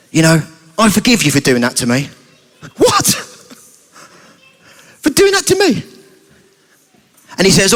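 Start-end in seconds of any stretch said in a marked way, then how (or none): 0.1-2.1 low, calm, forgiving voice; understated, earnest acted forgiveness; sincere forgiveness. Sheldon calmly forgives Chucky. 2.8-5.8 very high, incredulous squeak; hammed-up outraged surprise; indignant disbelief. Chucky incredulous at being forgiven.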